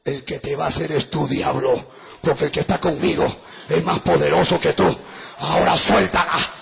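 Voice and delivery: very low, deliberate confrontation; menacing command. Retold or imitated. Pastor confronts the devil, low commanding voice. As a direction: press down low on the devil, firmly.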